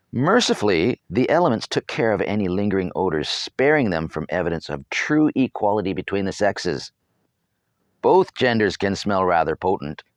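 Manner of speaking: usual speaking voice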